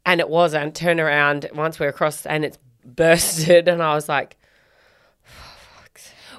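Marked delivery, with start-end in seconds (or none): 5.2-6.4 breathed under the breath; a hushed, exasperated whisper; whispered curse. Retold or imitated. Mum whispering a curse at her daughter's bleeding toe. Muttered on seeing the blood.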